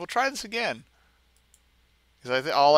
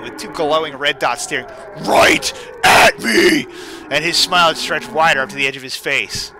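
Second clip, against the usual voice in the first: reading a creepypasta out loud, a bit louder, with some drama; creepy story narration; 3 semitones higher, 7 dB louder than usual. A narrator's reading. Streamer reads a horror story to viewers, dramatic narration. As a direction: spin the spooky tale for the stream.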